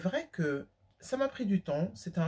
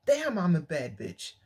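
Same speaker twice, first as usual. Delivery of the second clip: put-on slow, self-assured English line in an American accent; confident English swagger; slower than usual. Imitating confident American women, slow English swagger. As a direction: savour it like a proud American woman admiring herself. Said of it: "elles font".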